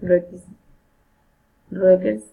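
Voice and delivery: normal talking voice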